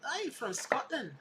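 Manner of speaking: lightly put-on Scottish accent that slips toward Irish; a quick try at a Scottish accent. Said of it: "Actually, that was more Irish".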